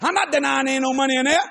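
ordinary talk, nothing marked

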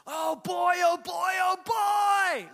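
put-on high, drawn-out delighted old man cry; an overjoyed old hiker bursting into the cabin; happy old man. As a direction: stretch it out loud and high like a giddy old hiker. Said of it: "he was so happy, and he goes".

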